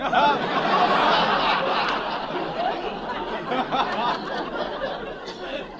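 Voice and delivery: a long airless wheezing laugh, falling; wife's breathless laugh. Acted. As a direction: laugh silently in fits at his expense. Wife's breathless laugh at his pain.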